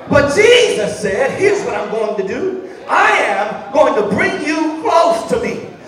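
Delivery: sing-preach the promise with fire; preaching at full force. Acted. Preacher to congregation, sung whooping climax.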